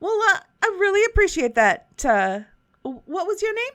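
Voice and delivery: put-on a light Southern shopkeeper accent. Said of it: "It just continues to go Southern".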